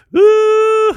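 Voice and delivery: loud high whoop; cheer. Real, not acted. Streamer cheering at his own dad joke, loud whoop. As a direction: let out a big whooping cheer. Cheering after laughing fit.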